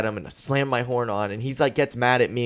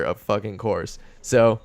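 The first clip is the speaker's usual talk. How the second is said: normal talking voice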